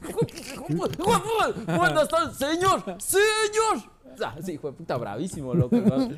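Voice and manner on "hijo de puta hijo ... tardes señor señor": high, loud, mock-angry father-in-law; mock furious suegro. Imitating the furious father-in-law shouting at his daughter's boyfriend. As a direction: ham up the angry old man. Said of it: "y dice".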